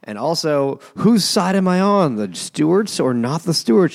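high, loud, theatrical villain; mock scheming Duke. Duke teasing about his loyalties, hammed-up. Voicing the scheming Duke's monologue.

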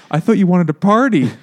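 hammed-up come-on; mock seductive mom. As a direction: play the pushy older woman.

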